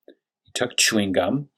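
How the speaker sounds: ordinary delivery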